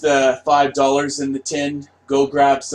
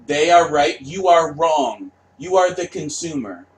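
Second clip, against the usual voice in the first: ordinary talk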